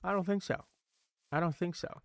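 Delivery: a light, hushed take on Elastigirl, firm and motherly; lightly voiced superhero mom shutting it down; soft, understated Elastigirl impression. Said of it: "when I was like".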